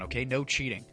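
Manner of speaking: ordinary delivery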